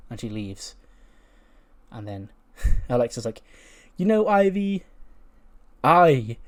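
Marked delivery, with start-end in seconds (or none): 4.0-6.4 high, drawn-out mock-sweet request; wheedling. Alexa angling for a coffee from Ivy, wheedling. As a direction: lift it high and loud, coaxing with pauses.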